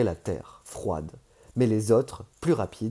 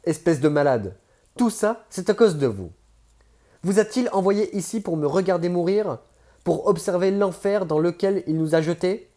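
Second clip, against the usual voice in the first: higher, louder, accusing voice of a wounded man; bitter accusation; 7 semitones higher, 5 dB louder than usual. Acted. A dying man accuses Page's captor, bitter and loud. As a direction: spit the blame at him.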